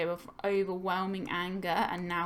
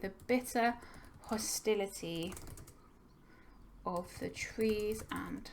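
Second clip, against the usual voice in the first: slow dictated quote; 5 dB quieter, slower than usual. Shown as a demonstration. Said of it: "I'm gonna use that quote".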